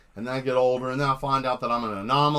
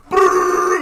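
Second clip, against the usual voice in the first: loud high buzzing robot rumble; mock machine noise; 10 dB louder than usual. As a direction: rev it like a rampaging robot. Robots tear everything apart.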